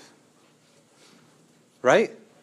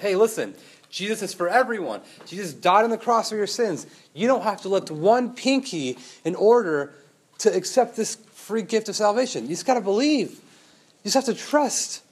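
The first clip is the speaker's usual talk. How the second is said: Paul's earnest plea; 6 semitones higher, 5 dB louder than usual. Acted. Paul voiced preaching the gospel.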